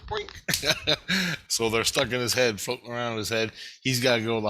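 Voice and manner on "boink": cartoon boink. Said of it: "I just took 'em and went".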